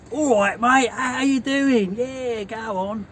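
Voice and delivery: exaggerated southern "all right, mate" accent; southern English accent. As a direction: put on a laid-back southern geezer accent. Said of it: "you sound really southern".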